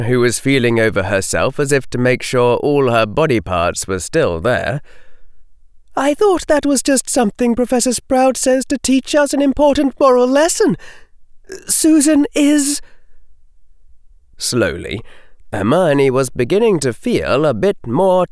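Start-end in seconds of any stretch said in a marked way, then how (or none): 6.0-12.8 put-on high girlish character voice; doubtful schoolgirl. Hannah doubting the Hufflepuff story, high girlish voice. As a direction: play a young witch puzzling it out, pitched high.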